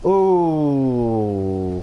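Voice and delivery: long "ooh" sliding down in pitch; drawn-out "ooh". Acted. Reacting to yet another pink gumball, long falling "ooh". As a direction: let a mock-frustrated "ooh" stretch and fall.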